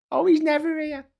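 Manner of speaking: high falsetto vox-pop voice; mock Clacton voter. Clacton local talking about an absent MP, mock falsetto. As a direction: squeak it out as a doting local.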